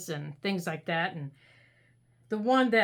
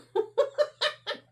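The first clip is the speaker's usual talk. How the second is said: high-pitched giggle. Real, not acted. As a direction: giggle at herself. Laughing at lost glue.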